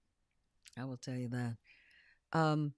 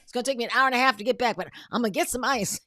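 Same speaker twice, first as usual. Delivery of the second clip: hasty husband's excuse; 6 semitones higher, 11 dB louder, much faster than usual. Retold or imitated. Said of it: "He runs to the other party".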